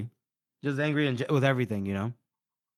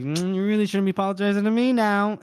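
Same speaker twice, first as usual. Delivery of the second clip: high, quick, pointed hint; pointed correction; 6 semitones higher, 3 dB louder, faster than usual. Acted. He redirects the apology away from himself, pointed. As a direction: pitch it up and nudge him briskly.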